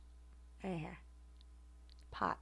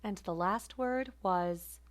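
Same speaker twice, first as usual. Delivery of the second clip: ordinary talk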